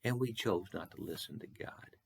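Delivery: hushed, unvoiced and solemn; whispered confession. Real, not acted. Preacher admitting the congregation's sin, whispered. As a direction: breathe it like a confession.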